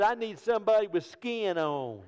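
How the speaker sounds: put-on small boy making his point; lightly voiced little boy; kid's voice. Clever son's retort.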